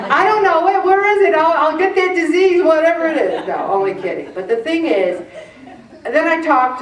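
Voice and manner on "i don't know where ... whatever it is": high and loud comic exasperation; excited joking rant. Acted. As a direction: playfully demand the miracle cure.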